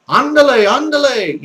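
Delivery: high, loud, spirited Spanish "andale"; Spanish accent. Shown as a demonstration. Teacher urging learners to sound Spanish, lively Spanish accent. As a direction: call it out like a Spanish speaker. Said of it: "try to sound like the Spanish do".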